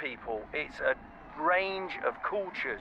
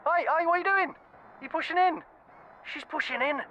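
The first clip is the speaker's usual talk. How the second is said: indignant; 9 semitones higher than usual. Acted. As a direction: call out the queue-jumper. Someone pushing into the queue.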